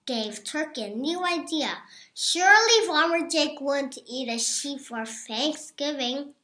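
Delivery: ordinary delivery, nothing marked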